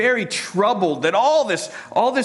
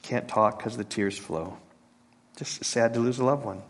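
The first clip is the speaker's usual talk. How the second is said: quiet grief; 5 semitones lower, 7 dB quieter than usual. Real, not acted. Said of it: "just quiet".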